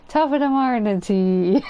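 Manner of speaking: hammy stage Irish greeting; Irish accent. Retold or imitated. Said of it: "an Irish, um, pub owner".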